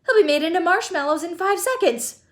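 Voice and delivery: high-pitched upset retort; snapped. A narrator's reading. Mother predicting son's doom, high snapping. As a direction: protest in rising alarm. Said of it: "snapped Missus Gloop".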